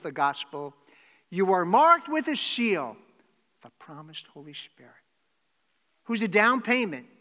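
loud, high, driving sermon voice; preaching at full force. Acted. Preacher to congregation, the risen Jesus greeting them, loudly. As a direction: proclaim it to the back row.

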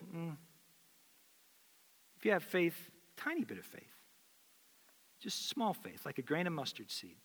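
soft, hushed answer; quiet and tender, reassuring the disciples; gentle Jesus. Retold or imitated. Pastor voicing Jesus answering disciples, soft and gentle.

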